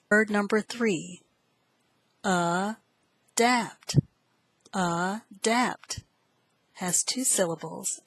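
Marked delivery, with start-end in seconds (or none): 2.2-4.0 "adapt" broken into a, then dapt; syllable by syllable. Shown as a demonstration. Teacher sounds out a vocabulary word, "adapt" split into syllables. 4.7-6.0 "Adapt" split into a, then dapt; syllable by syllable. Shown as a demonstration. Teacher repeats the word for students, "Adapt" broken into syllables.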